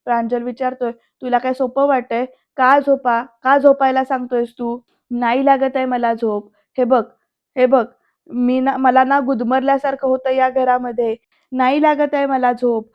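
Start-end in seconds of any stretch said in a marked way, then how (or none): none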